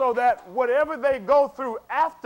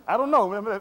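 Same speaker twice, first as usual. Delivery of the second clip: ordinary talk, nothing marked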